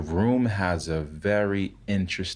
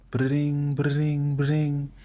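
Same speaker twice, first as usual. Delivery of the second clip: higher pitched bring of a ringing phone; imitated phone ring; 8 semitones higher, much slower than usual.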